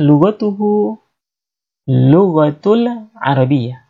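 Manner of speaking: slow first take. Shown as a demonstration. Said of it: "Misalkan kita mengatakan".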